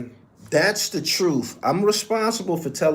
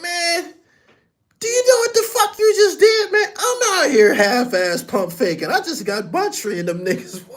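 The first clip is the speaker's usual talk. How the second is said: high, loud, laughing through the rant; cracking up while voicing the angry boss; laughing mock scolding; 4 dB louder than usual. [name] berating [name] over the Crips, high laughing mock rant.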